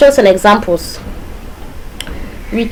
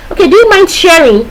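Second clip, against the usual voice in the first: teacher; 7 semitones higher than usual. Acted. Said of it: "Probably I'm teaching".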